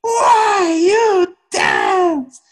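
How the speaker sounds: very high, slow, stretched-out shout; hammed-up hotheaded teacher bellow, every word dragged; imitated yelling. Angry teacher challenges silent dancers, imitated drawn-out yelling. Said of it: "He just kept yelling".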